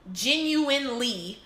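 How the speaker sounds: slow, emphatic "genuinely" after fumbling for it; careful word-finding. Real, not acted. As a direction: land hard on "genuinely", syllable by syllable. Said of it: "that's the word I'm trying to say".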